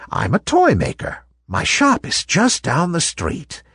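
put-on a kind old lady revealing a secret; kindly old toy maker.

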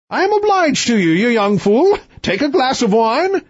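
high, imperious royal voice; haughty king. Acted.

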